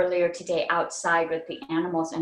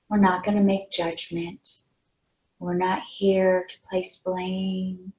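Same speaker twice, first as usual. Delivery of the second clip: unhurried meditation guide, pausing between phrases; guided meditation; slower than usual. Real, not acted. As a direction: lead them gently into relaxation, leaving long pauses. Leads a meditation.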